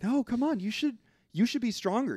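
a hint of a coaxing girlfriend; coaxing dream woman. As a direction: urge him on sweetly. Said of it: "dream version of her is like".

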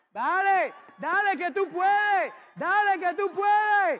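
put-on loud, slow cheering on a toddler; cheering parent. Father shouts encouragement to his crawling son, loud and slow. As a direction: urge the child on with everything you have.

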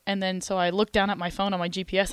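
usual speaking voice

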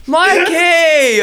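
high, loud laughing shout of his name; indignant yelp. Real, not acted. Calling out the friend who fooled her, high laughing shout. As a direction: cry out at the prankster.

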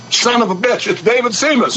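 put-on old rancher recognizing a boy after thirty years, high and amazed; astonished recognition. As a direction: blurt it out in shock at the sight of him. Old cowboy recognizing him, astonished.

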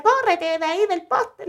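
high, slowed, stretched-out call; retold urgent call. Niece telling aunt to get away from the pole, urgent call.

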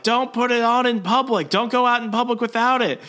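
high, urgent acted holler; mock frantic warning. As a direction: imitate someone yelling orders while hauled away. Peter yelling while dragged off.

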